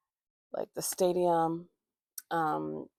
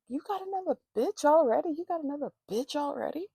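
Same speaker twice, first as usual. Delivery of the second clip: high, fast mock confrontation; rapid high-pitched accusation, acted out; imitating angry ex; 8 semitones higher, faster than usual. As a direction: mimic an outraged girlfriend, pitched way up. Voices angry ex confronting him.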